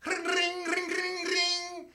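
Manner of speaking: high sung ring ring; bike bell imitation. Player riding his bike, imitating the bell, high. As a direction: chime it like a little bell.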